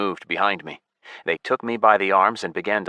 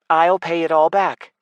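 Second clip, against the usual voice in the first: put-on higher, louder young voice; elf girl; 10 semitones higher, 5 dB louder than usual. A narrator's reading. Elf girl offering to pay the centurion, higher voice. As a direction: offer it eagerly.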